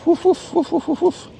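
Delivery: very high yappy woofs at the dog; dog barking impression. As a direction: bark like a tiny dog.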